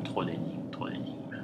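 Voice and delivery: fading into a whisper. Real, not acted. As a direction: breathe the words out, trailing off. Repeats the phrase, fading out.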